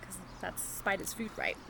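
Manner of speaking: ordinary delivery, nothing marked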